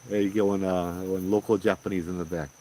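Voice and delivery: ordinary talk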